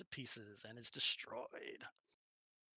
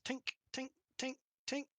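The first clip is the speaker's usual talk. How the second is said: bouncing tinks; 10 semitones higher, 3 dB quieter than usual. Acted. Orb bouncing down steps.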